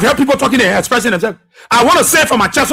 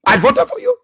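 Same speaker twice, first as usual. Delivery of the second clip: high, wailing imitation of a sob; mock crying; 13 semitones higher than usual. Regretful supporter wailing at the president, mock crying. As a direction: wail it like a weeping voter pretending real tears. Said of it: "They are crying real tears".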